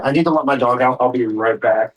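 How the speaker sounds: ordinary talk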